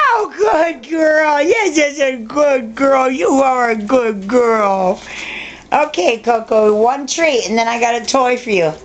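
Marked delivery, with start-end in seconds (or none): 0.1-5.0 excited praise. Real, not acted. Owner praising Missy for turning around, excited gushing shout. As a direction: gush over the dog like she won a prize. Praising dog after trick.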